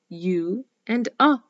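U as a long "yoo", A as a short "uh". Shown as a demonstration. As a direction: contrast a held "yoo" for U with a quick "uh" for A. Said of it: "The letter U has the sound".

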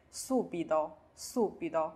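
subito starting with hissed su; voiceless s. Shown as a demonstration. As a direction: model subito slowly with an unvoiced s. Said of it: "s拼上u su".

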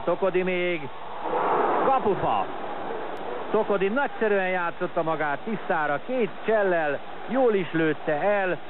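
high, excited near-miss call; excited hungarian sports commentary. Real, not acted. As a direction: cry out at the post.

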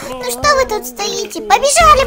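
ordinary talk

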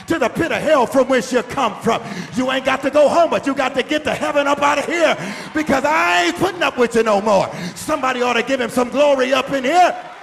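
loud, high preacher shout; full-force preaching. Real, not acted. Preacher stirring the congregation to give glory, full-force preaching. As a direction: preach at the peak of fervour.

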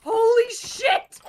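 high, slow shocked yell; real astonishment at surviving; shocked shout. Real, not acted. Survives a huge fall.

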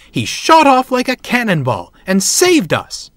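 high, loud, thrilled report; excited shout. A narrator's reading. Saved passengers telling the station master, excited shouting. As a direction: gush it loudly to everyone around. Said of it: "they shouted".

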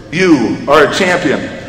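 ordinary talk

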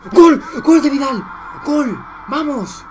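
very high, full-volume goal yells; goal celebration. Real, not acted. Fan celebrating Vidal's goal, screaming high and loud. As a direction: roar it out for your team's goal.

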